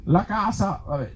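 ordinary talk

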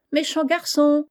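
mischievous little voice. A narrator's reading. Little voice in the box teasing the boy, mischievous. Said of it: "en riant d'un air malin".

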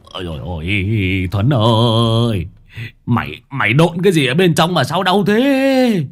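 in pain. Acted. Old master clutching his kicked foot.

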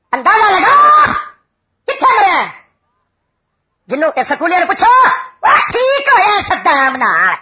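high, stretched-out preacher's shout; impassioned sermon outburst, pitched high; full-force preaching. Real, not acted. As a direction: rouse the crowd with long rising cries.

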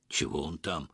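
low, soft sullen question; sullen, understated grumble; gloomy question. A narrator's reading. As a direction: ask glumly, keeping it down. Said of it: "хмуро спросил Петро".

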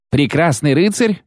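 high, rising echo of disbelief; incredulous question. A narrator's reading. Knight doubting Geralt is noble, incredulous question.

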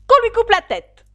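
high, very loud royal command; furious shout. A narrator's reading. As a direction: scream out an order of execution. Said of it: "elle se mit à crier". (cri de colère)

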